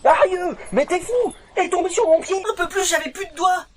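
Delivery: high, loud frightened cry; panicked yelp. Acted. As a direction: shriek at the near miss. Blade just fell on his foot.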